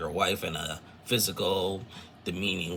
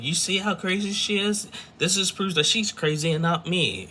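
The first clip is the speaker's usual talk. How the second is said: high, put-on whiny voice; much higher, mocking imitation of the wife; mocking Jamie; 11 semitones higher than usual. Wife complaining to producers, in a high mocking voice.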